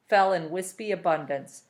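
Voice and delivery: plain reading voice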